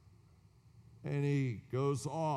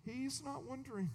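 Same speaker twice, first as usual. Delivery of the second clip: softer, higher, unhurried assurance; calm reassurance; 6 semitones higher, 7 dB quieter than usual. Real, not acted. Preacher comforting congregation, soft and high. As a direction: reassure them gently that God reigns.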